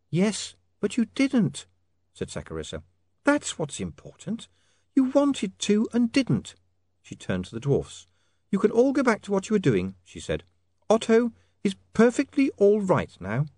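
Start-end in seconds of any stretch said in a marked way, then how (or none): 0.1-1.7 high, slow lady's voice; reassuring young woman. Acted. Sacharissa reassures Otto. 3.2-6.5 high, slow lady's voice; reassuring young woman. Acted. Sacharissa reassures Otto. 8.5-10.4 high lady's voice; firm young woman. Acted. Sacharissa dismisses the dwarfs. 10.9-13.4 high, insistent lady; firm young woman. Acted. Sacharissa dismisses the dwarfs.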